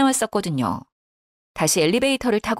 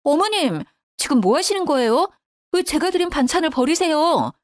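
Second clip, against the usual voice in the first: higher-pitched indignant daughter-in-law; angry outburst; 8 semitones higher than usual. A narrator's reading. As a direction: confront her in sudden outrage. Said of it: "너무 화가 나서 버럭 소리를 질렀습니다".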